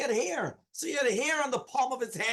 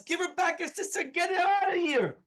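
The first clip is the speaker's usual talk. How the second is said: retold scream; 8 semitones higher than usual. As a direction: imitate a panicked officer screaming orders in a high voice. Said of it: "He starts screaming".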